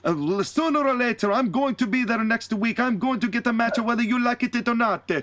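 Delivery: high-pitched mock-Italian wrestler, defiant; Santina Marella. Santina vows to Arn to get a match, high mock-Italian voice. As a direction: put on a high, exaggerated Italian accent. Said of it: "And Santina goes".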